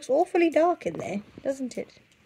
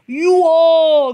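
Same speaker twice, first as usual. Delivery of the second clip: loud, higher pounce of a cry; fox cries out; 6 semitones higher, 11 dB louder than usual. Acted. Foxy Loxy roars at the birds as he pounces. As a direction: shout it as the fox leaps. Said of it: "cried Foxy Loxy".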